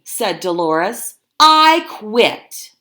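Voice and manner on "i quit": high, loud, quitting for good; loud defiant quit. Acted. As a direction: slam down the decision, high and loud. Dolores quits loudly.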